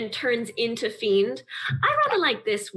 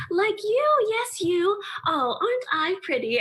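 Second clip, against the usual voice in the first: much higher, doting coo; preening bullywug; 9 semitones higher than usual. Acted. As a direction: flirt with the crowd as a vain frog lady. Morphs into bullywug, coos.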